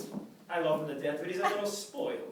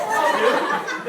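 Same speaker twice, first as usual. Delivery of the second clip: laughing exasperation; 10 semitones higher, 13 dB louder than usual. Real, not acted. Exasperated reaction.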